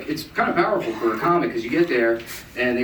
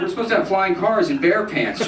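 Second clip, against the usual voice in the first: mock complaining audience; 8 semitones higher than usual. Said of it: "you're like".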